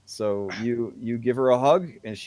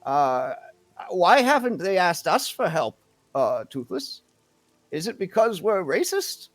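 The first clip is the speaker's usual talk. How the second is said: higher, emphatic elder's voice; outspoken elder townsperson; 7 semitones higher, 5 dB louder than usual. Acted. Elder asks them to sort out the lizard folk, higher emphatic voice. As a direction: speak up frankly, pitch swooping upward.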